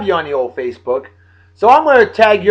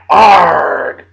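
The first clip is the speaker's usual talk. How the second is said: loud pirate arr; pirate growl; 5 dB louder than usual. Pretend. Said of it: "I'm mad".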